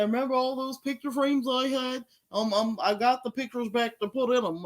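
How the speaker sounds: put-on voice mimicking a woman; imitating her voice. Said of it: "always have to do her voice".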